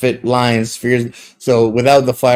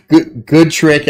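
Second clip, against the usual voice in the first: sarcastic laughing praise; 5 semitones higher, 4 dB louder, much slower than usual. Acted.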